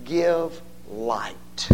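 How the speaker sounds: to give light drawn out very slowly; slow and deliberate. Shown as a demonstration. Preacher spelling out the meaning, very slowly. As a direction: linger on each word of to give light. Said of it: "literally means".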